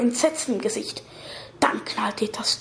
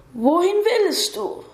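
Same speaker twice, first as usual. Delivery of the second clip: high, slow, drawn-out ghostly question; 6 semitones higher, slower than usual. A narrator's reading. Red-haired ghost questions the girl, high slow voice. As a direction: stretch the question out at a raised pitch.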